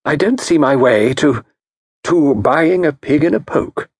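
faltering, refusing; lightly voiced hesitant wife. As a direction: back out nervously. Mrs Packington hesitant.

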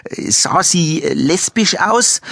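high, slow, drawn-out blurt. A narrator's reading. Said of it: "platzte es aus Kastner hervor".

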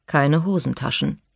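ordinary delivery, nothing marked

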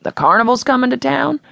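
put-on older man who can't believe the news; disbelieving older man. A narrator's reading. Townie man asking the carnival man, disbelieving. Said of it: "an older man's voice with a disbelieving tone".

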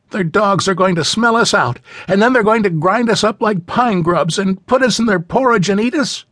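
put-on high, breathless child's voice; a small girl spinning a scary tale; frightened little girl. A narrator's reading. Little girl scaring the other children about the giants, high and anxious.

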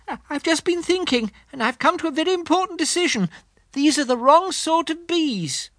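high, earnest little bear voice; a thoughtful teddy bear making a decision; Pooh voice. Acted. Pooh voice in the story.

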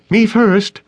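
high, loud eager shout. A narrator's reading. Crystal claims first place on the raft, shouting. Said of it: "Crystal shouted".

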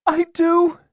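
put-on very high, loud girl's voice; distraught girl. A narrator's reading. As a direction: plead as a frightened young girl. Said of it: "Tears spilled down her cheeks".

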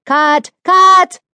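high, loud yell building up; director yelling. A narrator's reading. Director yelling cut at the crew. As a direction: bellow it across the set. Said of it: "A screaming director yells".

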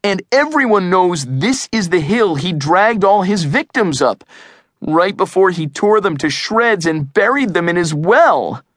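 high-pitched, excited insistence; worked-up kid's protest. A narrator's reading. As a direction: press the gory case urgently, pitch up. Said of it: "Eugene protested".

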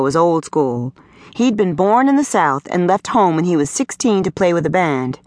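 ordinary delivery, nothing marked

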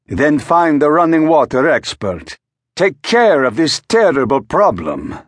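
a touch of grand impatience; exasperated tenor. A narrator's reading. Tenor demanding his valet fix the water, exasperated. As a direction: wave it off like a fretting star.

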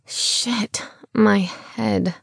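whispered groan of a hangover; pained whisper. A narrator's reading. Hungover woman whispering to herself in pain. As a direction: breathe it out, head pounding.

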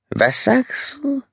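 slow, high-pitched dazed woman's voice; dazed murmur. A narrator's reading. Bitten woman to her vampire companion, dazed murmur. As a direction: murmur it slowly and high, half stupefied. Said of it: "murmelte sie, immer noch benommen".

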